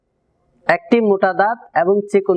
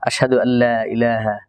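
closing prayer; 6 semitones lower than usual. Acted. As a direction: murmur the closing prayer devoutly. Closing dua. (নিচু স্বরে দোয়া পাঠ)

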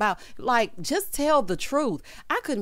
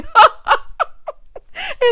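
loud high-pitched cackle; big laugh; 10 dB louder than usual. Real, not acted. Cracking up at own joke, loud laughing.

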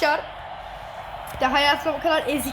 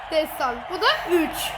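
ordinary talk, nothing marked

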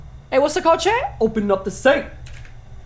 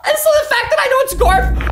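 high-pitched frightened shouting; panicked shout; 11 semitones higher than usual. Real, not acted. Player chased by Garfield, panicked shout. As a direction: shout it high mid-scare.

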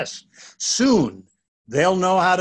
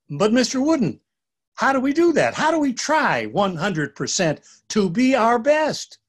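put-on higher, eager children's question; curious kids; 7 semitones higher than usual. Boys asking their teacher how, higher and eager. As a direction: ask like puzzled young boys.

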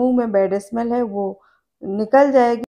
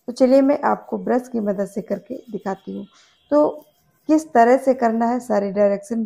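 talking with toothbrush in mouth. Real, not acted. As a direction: brush pressed against front teeth. Seen 0: Brush held in teeth until 11.5, then finger rubs teeth.

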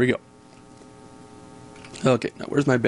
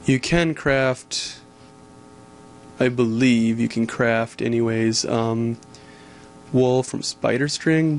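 ordinary delivery

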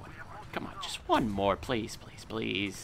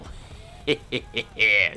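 cartoon laugh; 8 dB louder than usual. Real, not acted. Laugh after double kill.

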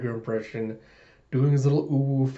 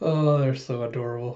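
normal talking voice

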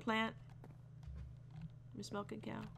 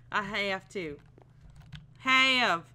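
put-on drawled accent stretching HAVE, getting louder; 8 dB louder, much slower than usual. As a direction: lean into a silly accent on "have". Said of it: "these accents".

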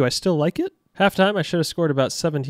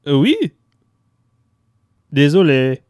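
mock French phone call; 7 dB louder than usual. Pretends Rabiot's mother calls him.

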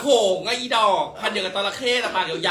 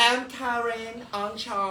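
posh self-introduction; 2 semitones higher, 7 dB quieter, much slower than usual. Retold or imitated. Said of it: "คาเรนอ่องชอม".

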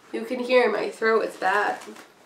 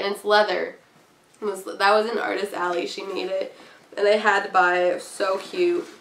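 ordinary delivery

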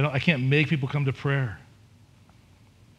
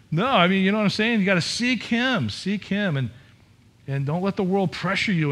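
fervent preaching; 6 semitones higher than usual. Real, not acted. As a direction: press it home to the congregation. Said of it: "preaching at full force".

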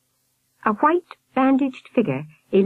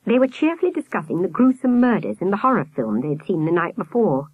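ordinary delivery, nothing marked

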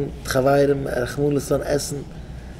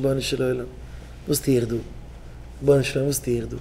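soft plea; 8 dB quieter than usual. Acted. Acted prayer of sinner.

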